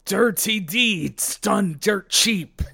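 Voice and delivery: slow, loud, drawn-out battle cry; shouted stand name. Acted. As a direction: belt out each word like an attack call.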